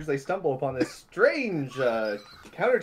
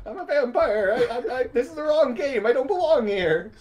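high, laughing stammer; flustered vampire; 8 semitones higher, 4 dB louder than usual. Acted. Vampire character protesting she is in the wrong game, flustered. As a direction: stumble over the words in a fluster.

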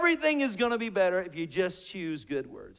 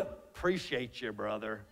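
low, quiet offhand reply; imitating reticent relative; 13 semitones lower, 11 dB quieter than usual. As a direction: imitate a relative dodging "love", dropping low and soft. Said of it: "Watch this".

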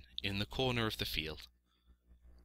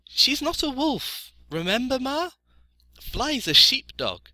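very high, loud piglet voice; little piglet, explaining; 8 dB louder than usual. A narrator's reading. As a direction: correct her in a small pig's voice.

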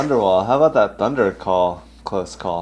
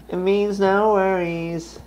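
mock slow voice; 10 semitones higher, slower than usual. Jokey slow repeat.

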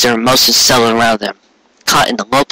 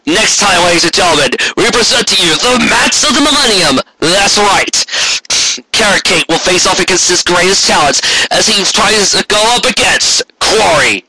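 match announcer; 8 semitones higher than usual. Acted. Narrator hypes the next episode's match, announcer style. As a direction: sell the next episode like a title fight.

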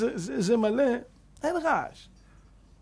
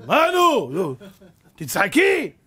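angry demand; 6 dB louder, much slower than usual. Retold or imitated. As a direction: yell at her to laugh. Husband demands wife laugh. (בעל צועק על אשתו שתצחק)

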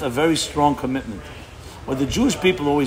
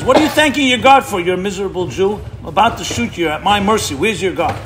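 higher, louder mock-angry shout; retold angry guard; 5 semitones higher, 5 dB louder than usual. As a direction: bark it at the prisoner like an enraged guard. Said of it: "the Nazi guard gets all upset and says".

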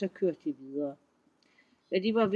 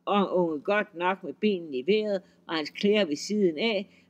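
plain reading voice

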